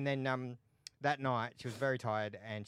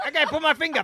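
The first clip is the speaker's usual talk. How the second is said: much higher, louder cheeky pitch; hammed-up flyerer; 17 dB louder than usual. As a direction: call out to passers-by like a prankster. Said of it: "Be like".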